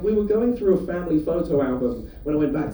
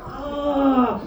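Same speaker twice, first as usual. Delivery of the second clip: high pained groan of being hit; short high cry of pain; pained yelp; 6 semitones higher than usual. Retold or imitated. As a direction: yelp as if hit below the belt. Hit in the goolies.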